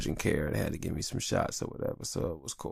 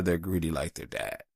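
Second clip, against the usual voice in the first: ordinary talk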